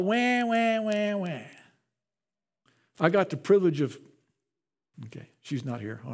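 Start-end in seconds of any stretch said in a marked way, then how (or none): 0.0-1.4 imitated whiny baby crying, fading off; mock baby wail. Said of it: "Just for no reason at all".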